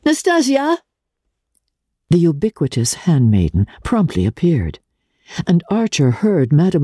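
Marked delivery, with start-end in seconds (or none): 0.1-0.8 high call across the house. A narrator's reading. Madame Olenska summoning her maid, a high call. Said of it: "and called out".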